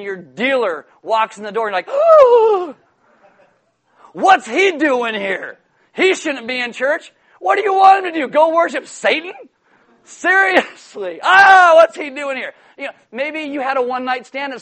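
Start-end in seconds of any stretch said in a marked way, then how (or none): 1.9-12.5 high, loud, drawn-out outrage; scandalized shock. Acted. Churchgoer spotting their drug dealer in church, mock scandalized. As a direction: cry out in hammed-up horrified disbelief.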